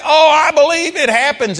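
high, loud gushing believer; mock eager believer. Preacher mimicking an eager churchgoer, high and gushing. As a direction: gush like a crowd that's all in.

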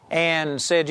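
ordinary talk, nothing marked

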